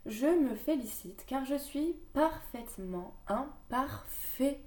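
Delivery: mantra recital. Acted. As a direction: say it slowly like a vow to yourself.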